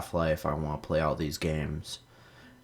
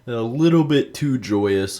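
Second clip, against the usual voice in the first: excited; 5 semitones higher, 8 dB louder than usual. Real, not acted. Excited joke about singing.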